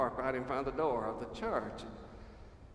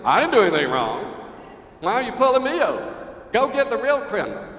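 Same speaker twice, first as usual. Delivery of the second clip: loud, higher mock outrage at the cop; indignant driver; 7 semitones higher, 11 dB louder than usual. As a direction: shout back like a driver pulled over, offended. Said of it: "We roll the window down and go".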